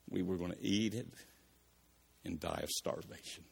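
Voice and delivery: a starving widow's quiet resignation; despairing widow. Acted. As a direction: give up hope softly, fading out.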